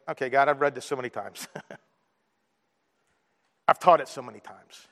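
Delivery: low, soft, offhand complaint; a mild, retold protest with a chuckle; reluctant grumble. Preacher telling God he has read this before, reluctant.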